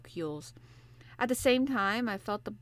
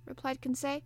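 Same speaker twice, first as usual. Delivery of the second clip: ordinary delivery, nothing marked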